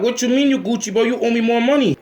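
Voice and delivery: high-pitched mock-outraged demand; indignant. Acted indignant friend demanding more.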